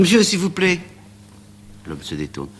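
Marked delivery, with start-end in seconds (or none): 0.0-0.8 cri bien plus aigu et plus fort; appel crié. Acted. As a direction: retenir l'homme avant qu'il disparaisse. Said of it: "Amanda lui crie". (Amanda crie au marchand pour le retenir, voix très aiguë)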